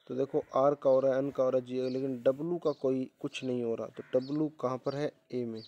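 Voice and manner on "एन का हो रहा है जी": usual speaking voice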